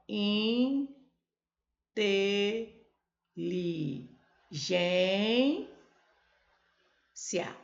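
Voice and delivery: Inteligência stretched out very slowly, syllable by syllable. Shown as a demonstration. Teacher answering how the syllables split. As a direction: spell out Inteligência in slow separate beats. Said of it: "Como separa a sílaba?".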